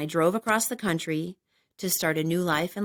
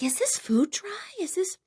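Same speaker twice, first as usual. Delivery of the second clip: worried whisper; 11 semitones higher, 5 dB quieter than usual. Retold or imitated. Stoned at dinner, a high alarmed whisper. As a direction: whisper it as your throat tightens.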